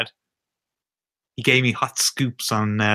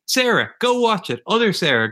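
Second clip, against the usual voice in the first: high insistent call; urging; 7 semitones higher than usual. Real, not acted. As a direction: push her to go watch it. Urging friends to watch.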